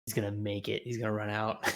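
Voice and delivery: normal talking voice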